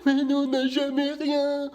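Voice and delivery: put-on high, slow child's whine; child whining at the beach; whiny kid. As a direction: plead like a spoiled kid.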